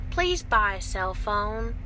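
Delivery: ordinary talk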